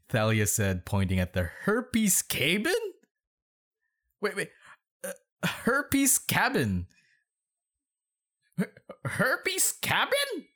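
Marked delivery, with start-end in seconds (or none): 1.6-2.7 high, questioning disbelief; incredulous. Real, not acted. Baffled by a misspelling. 4.2-10.5 slow, very high-pitched disbelief; incredulous. Real, not acted. Repeats misspelling in disbelief.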